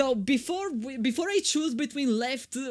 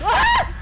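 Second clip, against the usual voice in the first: loud, high mock metal scream; 12 dB louder than usual. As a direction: burst out screaming like a thrash singer. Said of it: "My favorite song by Sepultura".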